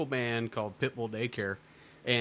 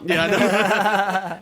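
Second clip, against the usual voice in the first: loud, slow, drawn-out agreement; 7 semitones higher, 10 dB louder, much slower than usual. Real, not acted. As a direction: stretch it out loud, laughing along. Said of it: "Fuck you, Grandma".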